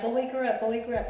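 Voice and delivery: loud, high, urgent retelling of go wake her up; retold frantic urging; urgent retold plea. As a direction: push it out fast and frantic, like a panicked call. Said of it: "she was crying".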